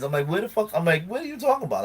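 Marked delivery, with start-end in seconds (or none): 0.3-0.7 high-pitched, baffled outburst; exasperated disbelief. Retold or imitated. Retelling his reply to friend's accusation, exasperated. Exasperated retort in retold quarrel. 1.1-1.8 very high, incredulous question; exasperated disbelief. Retold or imitated. Retelling his answer about the friendship, exasperated. Exasperated retort in retold quarrel.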